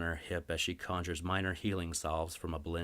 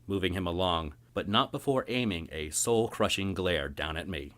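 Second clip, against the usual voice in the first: plain reading voice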